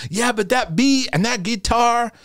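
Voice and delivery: slow, high Southern drawl of a complaining parent; mock a Southern parent griping. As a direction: parent grumbling about the beat in a Southern accent. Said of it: "sometimes I go in the Southern voice".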